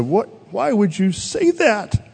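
high-pitched, distressed protest; retold hurt, pitched well up; mock tearful upset. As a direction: imitate a sister upset over her cat. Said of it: "started to cry and said".

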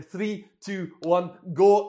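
high, loud countdown as a mock Scottish coach; Scottish accent. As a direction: belt out the start signal, imitating a Scottish accent. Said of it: "that Scottish voice again on the app".

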